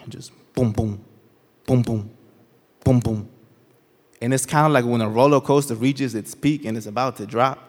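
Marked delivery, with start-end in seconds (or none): 0.5-3.3 low thumps in spaced pairs; imitated heartbeat. Storyteller voicing his heartbeat in the silent room, low spaced thumps. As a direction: beat out a heartbeat with the voice, pausing between pairs. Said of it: "All I could hear was my heartbeat".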